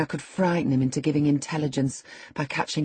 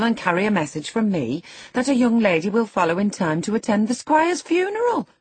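lively country servant, swooping high; chatty servant Zillah; 5 semitones higher, 3 dB louder than usual. A narrator's reading. Zillah greets the housekeeper found after the marsh, chatty and amazed. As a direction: gossip with high, rising surprise.

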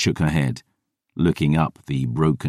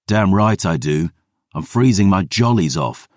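usual speaking voice

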